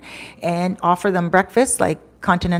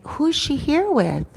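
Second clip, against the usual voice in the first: higher, slow, concerned asking; worried question; 8 semitones higher, 3 dB quieter, slower than usual. Retold or imitated. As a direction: ask slowly, pitched up with worry. Driver worried about the woman.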